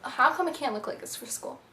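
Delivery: ordinary talk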